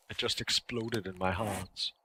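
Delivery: normal talking voice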